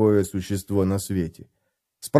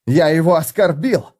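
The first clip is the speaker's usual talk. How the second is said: contemptuous scoff; 5 semitones higher, 3 dB louder than usual. A narrator's reading. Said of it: "презрительно усмехнулся Феликс".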